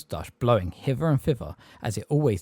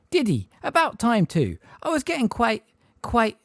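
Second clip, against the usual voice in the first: higher, prim aunt voice; a hint of a fussy aunt; Aunt Petunia; 12 semitones higher, 4 dB louder than usual. A narrator's reading.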